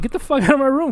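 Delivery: high-pitched, indignant demand; mock outraged outburst; retold angry order. Repeats the retold order to cop.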